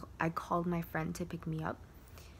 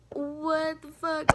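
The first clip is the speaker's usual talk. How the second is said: high, slow, louder mock shock; shocked outburst; 10 semitones higher, 7 dB louder, much slower than usual. Retelling her reaction to the boy, slow shocked outburst. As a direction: stretch it out, scandalised.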